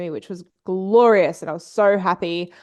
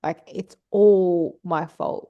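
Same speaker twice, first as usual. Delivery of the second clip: slow realization; slower than usual. Retold or imitated. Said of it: "huge realization".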